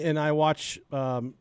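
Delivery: normal talking voice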